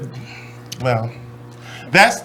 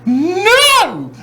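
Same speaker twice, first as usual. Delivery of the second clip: shocked; 14 semitones higher than usual. Retold or imitated. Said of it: "I was like".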